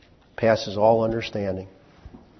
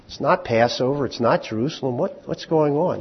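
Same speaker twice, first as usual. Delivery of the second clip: ordinary talk, nothing marked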